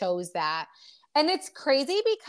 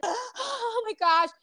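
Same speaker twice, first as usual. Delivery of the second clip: shocked gasp; 10 semitones higher, 3 dB louder, much slower than usual. Retold or imitated. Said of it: "And she's like".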